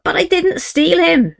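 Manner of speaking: put-on boy yells back at his father; desperate yell. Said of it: "Billy yelled back in desperation".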